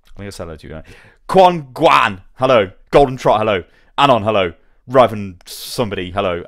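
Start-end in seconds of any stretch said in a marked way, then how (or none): 1.3-6.4 booming hellos, each falling hard; loud chat hellos. Real, not acted. Streamer shouting hellos to each viewer in chat, loud and hearty. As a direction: bellow every name out to the viewers. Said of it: "so I can destroy my voice even more".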